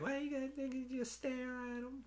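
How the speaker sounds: tiny high, soft voice; mock girl voice. Girl complaining about his staring, mock high soft voice. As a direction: squeak it quietly in falsetto.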